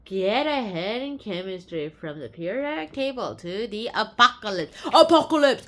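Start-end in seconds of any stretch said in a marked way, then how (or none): 4.9-5.7 dramatic shout. Acted. Dramatic echo of the title word.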